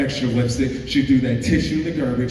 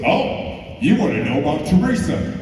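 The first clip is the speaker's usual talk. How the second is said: knowing principal; 5 semitones higher, 5 dB louder than usual. Retold or imitated.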